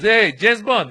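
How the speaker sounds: acted officer's excited shout-out; calling out. Passport officer tapping his colleague to show him, calling out.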